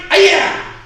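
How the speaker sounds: sharp high martial-arts yell; kiai shout. Shown as a demonstration. Instructor leading class drill, loud kiai shout. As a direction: shout the strike out from the gut.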